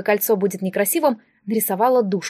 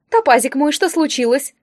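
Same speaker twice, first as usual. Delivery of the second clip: higher, louder cooing alarm; fussing concern; 4 semitones higher, 3 dB louder than usual. Acted. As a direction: fuss over a darling in distress. Distributor fusses over crying girl. (заботливое причитание, повыше)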